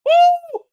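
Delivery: high, loud celebratory woo; joyful whoop. Real, not acted. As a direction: let out a victory whoop. Celebrating bigger portions.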